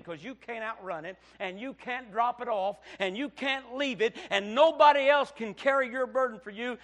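loud, driving preacher's appeal; preaching at full force. Acted. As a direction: hammer the point home from the pulpit.

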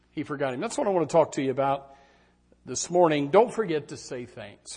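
ordinary talk

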